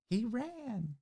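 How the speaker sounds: squeaky high mock voice; high-pitched squeak. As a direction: pitch it way up, softly. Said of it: "raises his voice really high".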